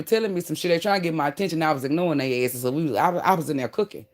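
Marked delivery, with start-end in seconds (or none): none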